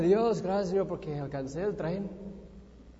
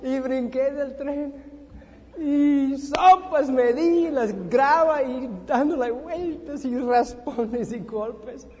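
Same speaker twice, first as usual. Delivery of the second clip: high, loud, slow vivid telling of the tumble; telling of rolling in the gravel; dramatic retelling; 5 semitones higher, 7 dB louder, slower than usual. Retold or imitated. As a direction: relive the crash slowly and loudly. Dramatic fall from the train.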